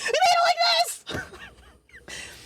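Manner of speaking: very high, drawn-out squealing shout; long, high-pitched wailing yell; exasperated screech. Retold or imitated. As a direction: screech it slowly in comic outrage. Exasperated outburst about the road.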